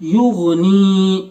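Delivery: युगनी very slow, ग़ joined to न; slow tutor recitation; jazm blending. Shown as a demonstration. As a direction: stretch युगनी syllable by syllable. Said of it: "गैन के ऊपर जज्म है".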